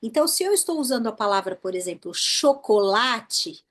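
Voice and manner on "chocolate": Portuguese "chocolate", very slow, long LA; stressed penultimate syllable. Shown as a demonstration. Teacher showing the Portuguese tonic syllable, "chocolate" stretched on LA. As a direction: dwell on the LA of "chocolate". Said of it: "aquela sílaba que a gente demora mais falando".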